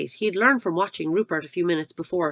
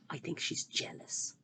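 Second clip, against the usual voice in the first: whisper; 3 semitones lower, 15 dB quieter than usual. Real, not acted. Reader's aside about a character.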